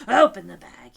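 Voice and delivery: plain reading voice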